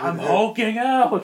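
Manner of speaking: high, loud, drawn-out yell; mock menacing shout. Bluffing Bruce to scare the soldiers, mock menacing shout. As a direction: stretch it out in a big hollered threat. Acting out Hulk-out threat to soldiers.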